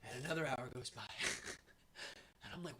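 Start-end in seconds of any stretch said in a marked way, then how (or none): none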